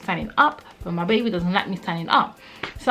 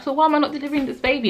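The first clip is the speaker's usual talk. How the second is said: high, rushed exasperated question; frustrated, annoyed; 8 semitones higher, much faster than usual. Retold or imitated. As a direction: demand it quickly in retold exasperation. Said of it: "frustrated and annoyed because I was so tired".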